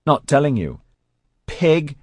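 put-on high child's voice, smug refusal; bratty kid's taunt; taunting little brother. A narrator's reading. Narrator voices boy taunting his sister.

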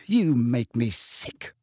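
slow, disgusted verdict. A narrator's reading. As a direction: finish him off slowly, with contempt. End of Lily's angry tirade.

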